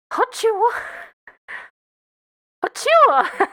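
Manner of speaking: a high comic squeal; squeaky put-on voice. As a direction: yelp it like a startled critter. Made-up exclamation.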